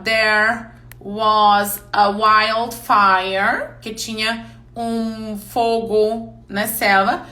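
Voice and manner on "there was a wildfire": very slow, every word of "Fire can be fire" stretched; slow teaching example. Shown as a demonstration. Teacher slows way down to explain "fire" to the class. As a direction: spell out "Fire can be fire" slowly for learners. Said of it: "Vou ajudar nisso".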